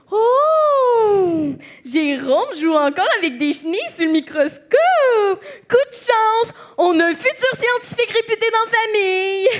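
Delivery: high, ecstatic mock marvelling; gushing relative. Relative predicting a future scientist, hammed-up ecstatic gushing. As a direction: squeal with overblown family pride.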